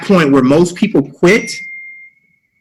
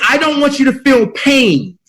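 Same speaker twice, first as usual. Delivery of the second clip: higher, coaxing inner voice; a higher pleading inner voice; voice of the mind; 8 semitones higher than usual. Acted. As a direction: play the mind talking you out of it. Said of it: "The mind says".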